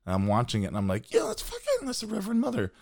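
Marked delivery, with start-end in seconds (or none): 1.1-2.7 high, excited realization; excited recognition. Retold or imitated. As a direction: blurt it out in excited surprise.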